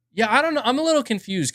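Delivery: high disbelieving yeah; incredulous. Real, not acted. Reacts to viewer comment.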